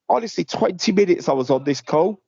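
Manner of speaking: normal talking voice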